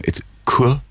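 It's "Cork" said like "Kwuh", rounded and open; posh Cork. Shown as a demonstration. Teaching the posh way to say Cork, rounded vowel. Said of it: "I'll give you a pronunciation".

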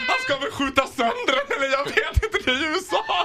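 high, laughing panicked thought. Retold or imitated. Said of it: "typ så här".

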